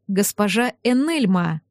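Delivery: ordinary delivery, nothing marked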